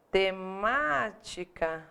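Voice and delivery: "Temática" said slowly, te-MÁ-ti-ca, stress on MÁ; slow syllables. Shown as a demonstration. Teacher to class, slowly marking the stressed syllable. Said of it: "Ela é proparoxítona".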